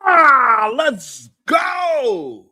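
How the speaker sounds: sudden high yell to jolt the audience; startling shout. Real, not acted. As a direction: burst out loud and high to scare. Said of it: "Did I scare you this time?".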